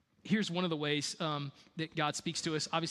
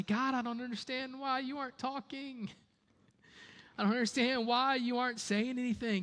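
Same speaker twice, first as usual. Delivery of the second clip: whiny complaining prayer; 6 semitones higher than usual. Pretend. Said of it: "look at God and say".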